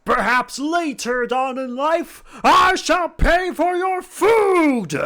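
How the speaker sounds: booming, slow, overjoyed himbo; dopey sweet himbo. Acted. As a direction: shout it like a delighted big lug. Bang promises.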